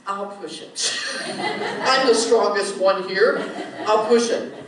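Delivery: loud, cocky boast; proud boast. Retold or imitated. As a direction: brag like the strongest man. Plumber boasting in story.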